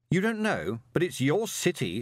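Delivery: high-pitched, incredulous sarcasm; sarcastic. A narrator's reading. Moist challenging the golem about his city, sarcastic. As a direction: throw it back at him sarcastically. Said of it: "said Moist sarcastically".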